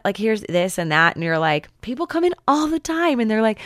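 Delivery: ordinary talk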